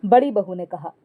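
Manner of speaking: ordinary delivery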